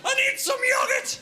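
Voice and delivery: high, slow, laughing yell; desperate shout. Retold or imitated.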